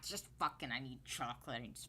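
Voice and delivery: a whispered meltdown over chocolate; frustrated whisper. Real, not acted. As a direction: hiss it under your breath. Muttering frustration under breath.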